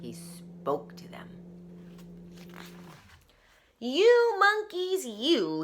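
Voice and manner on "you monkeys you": scolding peddler. Acted. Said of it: "he said, shaking a finger".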